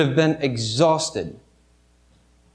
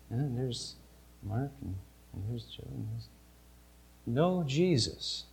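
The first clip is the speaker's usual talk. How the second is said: hushed dread; 5 semitones lower, 15 dB quieter than usual. Acted. Mother counting children, hushed, dreading the missing son. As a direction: let the words almost vanish as she looks.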